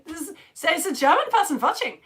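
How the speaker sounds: high, loud mock German accent. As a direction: put on a playful German accent. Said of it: "trying to do a German accent".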